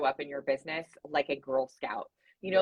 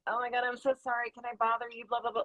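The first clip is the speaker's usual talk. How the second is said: higher-pitched, timid apologizing; sheepish little plea, higher than usual; meek apology; 5 semitones higher than usual. Acted. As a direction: act out someone embarrassed to bother you. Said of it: "a meek attitude".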